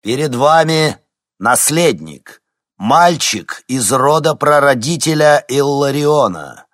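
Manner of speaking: loud, slow, high announcement; dark lord proclamation. A narrator's reading. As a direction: proclaim it to the whole hall. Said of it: "громогласно произнёс Константин".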